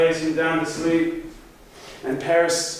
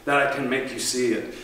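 plain reading voice

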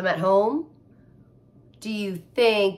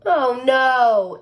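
groaning; 5 semitones higher, 9 dB louder than usual. Acted. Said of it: "Ava groaned".

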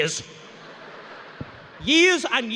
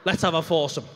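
firm, lowish mock-bold announcement; hammed-up certainty; confident declaration; 4 semitones lower than usual. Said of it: "the most confident thing I've ever said".

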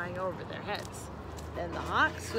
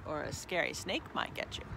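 low, softer cautioning voice; father frog warning; 6 semitones lower, 5 dB quieter than usual. A narrator's reading. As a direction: warn the young ones low and quiet. Father frog warns children.

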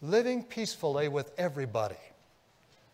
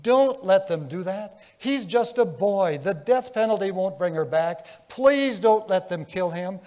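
louder, higher earnest pleading; begging; 5 semitones higher, 7 dB louder than usual. Retold or imitated. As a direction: beg them to spare the young killer. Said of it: "he begged".

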